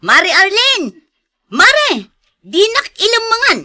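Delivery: loud, high call to the neighbour. Acted. Moneylender calling debtor out of her house, loud high shout.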